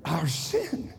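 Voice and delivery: rising, shouting preacher cadence; full-force sermon climax; preaching at full force. Acted. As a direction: preach it to the rafters, building.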